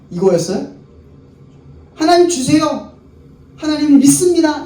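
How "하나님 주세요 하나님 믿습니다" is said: whiny demanding Israel. Retold or imitated. Mocking Israel's demands to God.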